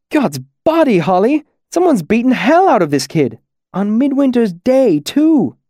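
high-pitched shocked outburst; appalled exclamation. Acted. Masked man tells his friend of a beaten kid, appalled.

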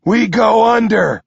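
high, loud urgent call; shouted answer. Acted. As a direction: call it out mid-run. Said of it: "Nine says".

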